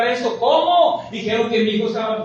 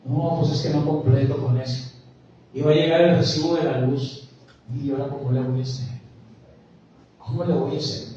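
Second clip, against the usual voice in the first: worried believer, low; 6 semitones lower than usual. Acted. Worried believer voiced in sermon.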